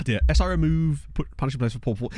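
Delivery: usual speaking voice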